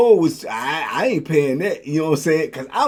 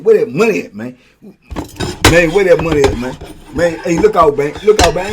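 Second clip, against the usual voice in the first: louder, insistent demand; 7 dB louder than usual. Retold or imitated. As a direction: press him hard for the money.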